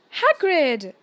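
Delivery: alarmed cry to stop him; shocked. A narrator's reading. Harry to Hagrid, startled by his grief. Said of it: "shocked to see Hagrid".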